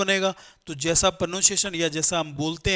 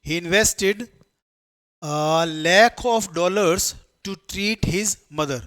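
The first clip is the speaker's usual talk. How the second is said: very slow reading; 3 dB louder, much slower than usual. Shown as a demonstration. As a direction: drag the sentence out word by word. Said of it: "question देखो". (बहुत धीरे पढ़कर दिखाना)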